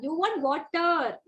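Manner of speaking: slow, drawn-out "You want water?"; clear model. Shown as a demonstration. Teacher models the question for a child, slow and clear. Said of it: "you have to model the same".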